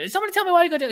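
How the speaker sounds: very high mocking Joker voice; high-pitched Joker. Imitating the Joker clip for a co-host, very high-pitched. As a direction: squeal it like a cackling villain. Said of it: "his high-pitched voice where he's like".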